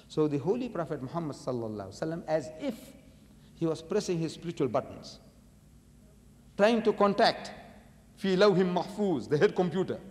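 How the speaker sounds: ordinary talk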